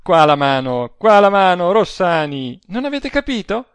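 loud, high, triumphant outburst; exultant. A narrator's reading. Adele asks for Rossani's hand, exultant. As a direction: shout it with wild glee.

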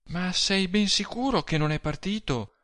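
high, worried questioning; anguished woman, pitch held high; growing anguish. A narrator's reading. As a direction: seek reassurance anxiously in a high voice. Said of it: "con crescente angoscia".

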